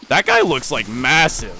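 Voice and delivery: loud, high astonished exclaim; surprised. Real, not acted. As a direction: blurt it out, amazed. Spotting huge enemy.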